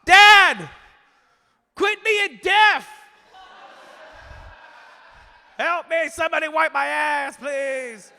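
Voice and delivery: very high, drawn-out mock yell; screaming for help. Boy stranded in bathroom yelling to deaf parents, mock screaming. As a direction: holler desperately for parents who can't hear, hammed up. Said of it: "started screaming for help".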